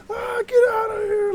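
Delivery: very high, strained holler; reenacted furious yell from under the roof; mad screaming. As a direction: scream at them to clear off. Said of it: "and I'm screaming".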